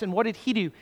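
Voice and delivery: ordinary delivery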